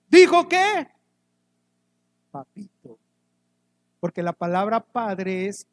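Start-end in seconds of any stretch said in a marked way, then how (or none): 0.1-0.9 much higher, louder call to the crowd; preacher drawing the answer from the congregation; loud prompting question. Real, not acted. 2.3-3.0 much softer and lower, like cooing to a dad; a child's loving name for his father; tender papito. Acted.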